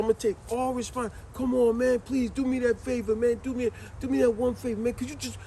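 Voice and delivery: high, pleading plea; begging friend. Retold or imitated. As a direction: beg for that one favor. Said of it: "he begged, he begged".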